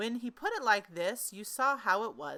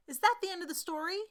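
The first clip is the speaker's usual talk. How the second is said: put-on child's voice; 11 semitones higher, 4 dB louder, faster than usual. Child voice of Christopher Robin.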